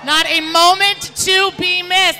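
parade commentator. Real, not acted. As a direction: announce the network like radio host. Station announcement on air.

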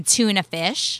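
tuna fish said the American way, TOO-nuh fish, drawn out slowly; American accent. Shown as a demonstration. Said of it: "I'll say it like an American".

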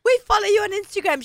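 high-pitched starstruck fan; retold fan excitement. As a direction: gush a little, pitched well up. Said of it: "we were all, like, starstruck".

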